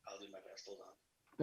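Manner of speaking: faint, far-off call audio; weak signal, voice barely there; barely audible. Real, not acted. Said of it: "still pretty faint".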